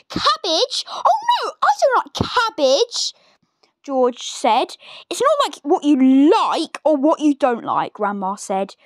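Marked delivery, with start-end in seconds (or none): 0.1-3.1 put-on much higher whiny boy's protest; horrified little George. 5.1-7.9 mild snap. Acted.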